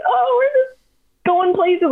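high, squeaky dodge; nervously vague, pitch way up; mock evasiveness. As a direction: dodge the question in a tiny high voice. Acted evasive answer to drunk men.

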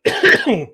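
real cough from choking on a drink. Real, not acted. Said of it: "Just choking on me, on me drink".

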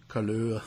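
couleur said the French way, koo-LUR. Shown as a demonstration. Host mimics Canadian French pronunciation, couleur the French way. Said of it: "same as color".

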